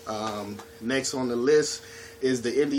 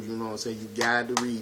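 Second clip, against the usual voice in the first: normal talking voice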